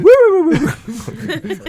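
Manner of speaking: high, loud mock ghost "woo"; ghostly wooing. Player acting out running out the back, high silly wooing. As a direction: whoop it out high and loud, playing spooky. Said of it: "Run out the back going".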